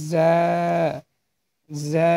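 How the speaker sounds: "জা" with a buzzing z, then a held vowel; buzzing consonant. Shown as a demonstration. Teacher models the letter for learners, "জা" opening on a buzz. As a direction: buzz the start of "জা" like a cricket. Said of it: "এই যে একটা শব্দ হবে".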